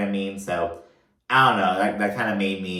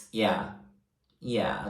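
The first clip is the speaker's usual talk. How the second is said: cold and detached; 6 dB quieter than usual. Retold or imitated. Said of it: "just so cold, so, like, detached".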